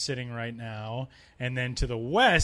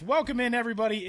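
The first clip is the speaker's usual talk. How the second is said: upbeat welcome; 8 semitones higher, 4 dB louder, faster than usual. Real, not acted. Show host greeting.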